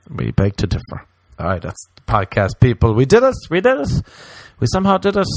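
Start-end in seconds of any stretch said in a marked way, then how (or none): 2.9-5.3 excited cheer. Real, not acted. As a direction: celebrate it up high. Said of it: "podcast end celebration".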